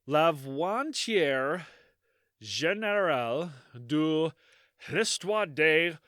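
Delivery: slow, exaggerated French accent reading a title; mock French accent, hamming up the title; bad French accent. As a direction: drawl it out in hammy French. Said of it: "my French accent".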